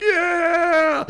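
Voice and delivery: high, loud whoop; mock cheer. Crowd cheering as the sign goes down, high whoop.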